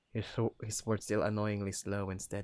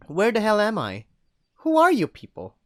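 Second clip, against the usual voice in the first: angry demand; 11 semitones higher, 12 dB louder than usual. Acted. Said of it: "I like the first one better".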